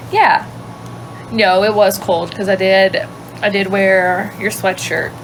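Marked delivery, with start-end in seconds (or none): none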